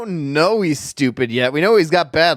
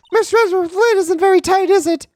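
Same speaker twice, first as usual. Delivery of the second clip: high-pitched teasing sidekick; high girl voice; 4 dB louder than usual. Acted. Voicing Maya.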